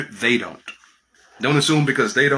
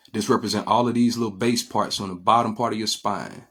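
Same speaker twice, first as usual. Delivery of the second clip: ordinary delivery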